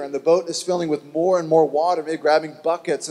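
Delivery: usual speaking voice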